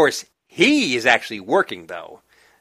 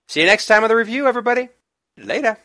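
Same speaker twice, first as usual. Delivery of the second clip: bright, higher, louder goodbye; cheery sign-off; 12 semitones higher, 5 dB louder, much faster than usual. Real, not acted. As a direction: sing out the goodbye.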